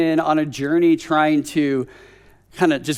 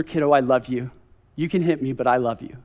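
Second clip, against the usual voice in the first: softer and lower, fading to a murmur; whispered love fading to nothing; tender fading love; 7 semitones lower, 7 dB quieter, faster than usual. Retold or imitated. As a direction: soothe the child, letting it fade away. Dad calming child after hit.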